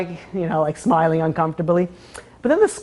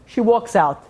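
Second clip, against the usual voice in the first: ordinary delivery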